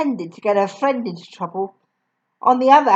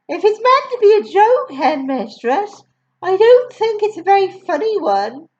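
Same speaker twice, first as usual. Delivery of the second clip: higher, gentle teacher voice; a hint of a reproachful young teacher; Miss Honey; 9 semitones higher than usual. Teacher objecting to the headmistress, gently higher. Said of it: "Miss Honey said from the back".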